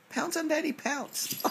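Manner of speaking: squeaky pet coaxing. Real, not acted. Dad coaxes Oliver to pounce in high pet voice. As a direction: egg the puppy on up high.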